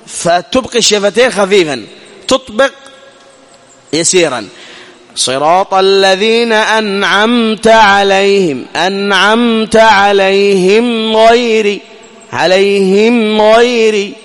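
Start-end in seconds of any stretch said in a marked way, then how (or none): 5.1-14.1 أنعمت عليهم غير slow, the meem closed briefly with a light hum; slow correct recitation. Shown as a demonstration. Teacher recites the correct version slowly for students. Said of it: "يكفي هذا".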